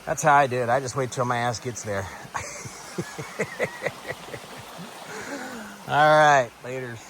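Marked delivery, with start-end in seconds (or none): 2.1-5.8 breathy high laugh after the joke; real laugh. Real, not acted. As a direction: laugh at your own crude joke. Laughs at his own joke.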